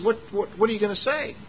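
high, quick retold questioning; lightly acted rapid-fire question; curious stranger. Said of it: "He says".

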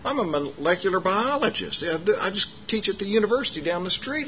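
high, softened voice for a retold stranger; lightly acted high-pitched interjection; stranger chiming in. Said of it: "this guy came up to him".

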